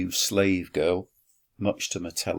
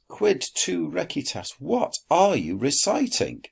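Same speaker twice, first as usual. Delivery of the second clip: put-on much higher, slightly cheeky boy voice; high voiced question; 10 semitones higher, 3 dB louder than usual. Quintus needling the poet, high and cheeky.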